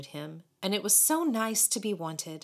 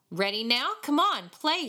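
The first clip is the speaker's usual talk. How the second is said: higher, impatient command; ordering; 7 semitones higher, 4 dB louder than usual. A narrator's reading. Tall boy commanding David to fiddle, ordering. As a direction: order him to start, building in volume. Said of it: "he ordered".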